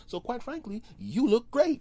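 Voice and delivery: mock compliment. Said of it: "And they'll go".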